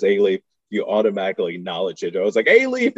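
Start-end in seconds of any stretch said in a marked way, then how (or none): 2.5-3.0 much higher and louder, calling out the name; excited recognition. Retold or imitated. Recognising his home neighborhood.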